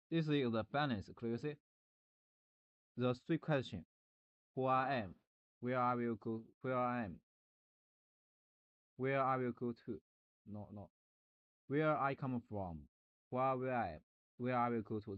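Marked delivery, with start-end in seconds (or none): none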